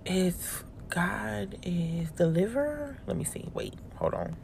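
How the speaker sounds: hushed, halting read while searching; soft, tentative reading aloud to herself; murmured to self. Real, not acted. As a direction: murmur it slowly under your breath.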